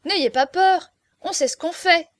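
loud, high, cocksure reply; self-important. A narrator's reading. Jean-Marie reassuring frightened Aurore, loud and self-important. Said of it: "répliqua Jean-Marie d'un air suffisant".

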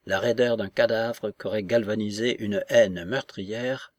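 ordinary delivery, nothing marked

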